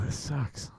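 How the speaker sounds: whispered, trailing away to almost nothing; defeated whisper. Real, not acted. Streamer giving up on the stubborn software, defeated whisper.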